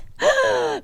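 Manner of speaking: very high, exaggerated gasp; an over-the-top squeaky gasp; group gasp imitated. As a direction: gasp like a whole shocked crowd. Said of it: "everyone else in the group goes".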